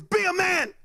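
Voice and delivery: high, punchy mock command; exasperated urging. Friend egging on the scared kid, exasperated order. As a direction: push him with acted exasperation.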